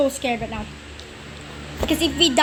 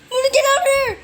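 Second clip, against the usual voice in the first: high, urgent cry of escape; alarmed yell; 10 semitones higher, 4 dB louder, much faster than usual. Pretend. Player fleeing danger in the game, alarmed yell.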